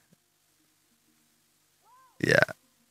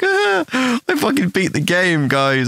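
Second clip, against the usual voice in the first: gloating laugh; 8 semitones higher, slower than usual. Retold or imitated. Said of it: "I was just laughing the whole time".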